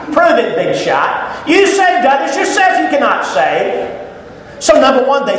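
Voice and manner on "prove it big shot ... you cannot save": slow jeering taunt; taunting insult. Acted. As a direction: dare him to prove it, sneering. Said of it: "they continue to throw those insults".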